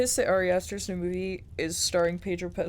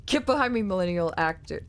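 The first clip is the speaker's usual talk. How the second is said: ordinary talk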